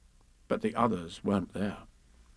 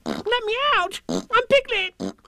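very high squealing protest; crying out; 4 dB louder, slower than usual. A narrator's reading. Piglet struggling in the bath, squealed cry. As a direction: squeal to be let out, stretching the end. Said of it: "cried Piglet".

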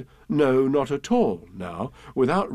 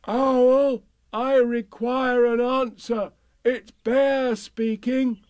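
high, drawn-out call; calling out loudly; 11 semitones higher, slower than usual. A narrator's reading. Pooh at Owl's door, calling out loudly. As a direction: call slowly through the door, pitched high. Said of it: "called out in a very loud voice".

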